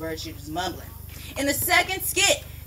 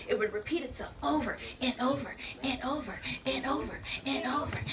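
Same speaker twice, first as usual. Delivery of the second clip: whispered repeat; 8 dB quieter than usual. Real, not acted. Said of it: "whispers the repetition".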